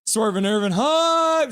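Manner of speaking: drawn-out, high-pitched hype call; slow, high crowd-rousing chant; hype cheer. Real, not acted. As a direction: stretch the last word into a rising holler. Leading hype cheer for donor.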